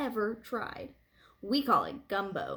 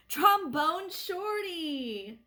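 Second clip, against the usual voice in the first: high, slow, drawn-out call; big brother proudly calls out to his little brother; 5 semitones higher, much slower than usual. A narrator's reading. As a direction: stretch the nickname out across the street. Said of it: "he called out".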